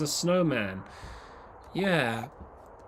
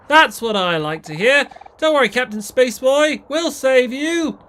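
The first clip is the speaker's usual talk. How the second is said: loud heroic voice; 8 semitones higher, 10 dB louder than usual. Acted. Voices eager hero.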